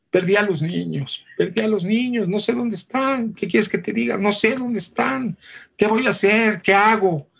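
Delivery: high, frantic pleading; husband confessing to his wife in mock panic; panicked confession. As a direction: blurt out the panic, pitch climbing. Julio confesses he lost kids.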